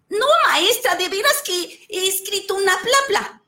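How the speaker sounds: high, pleading insistence; boy insisting to his teacher, high and earnest; insistent boy. Acted. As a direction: make her believe you. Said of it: "boy insists to teacher".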